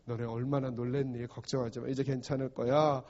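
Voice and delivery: low, soft, soothing words; calming reassurance. Acted. Preacher as Jesus after calming the sea, softly reassuring. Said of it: "마음을 안정시키고".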